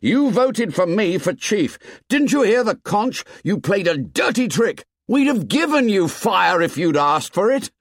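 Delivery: high, forceful outburst; angry boy hurling accusations; blazing temper. A narrator's reading. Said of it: "Ralph's temper blazed out".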